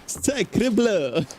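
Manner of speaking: high hammy French exclamation; French accent. Acted. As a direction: shout it like a cartoon Frenchman. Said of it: "another French joke".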